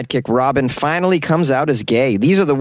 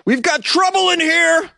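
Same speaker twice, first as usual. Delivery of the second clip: very high, urgent superhero cry; heroic yell. Retold or imitated. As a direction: call it out like a superhero. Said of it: "Donnie yelled".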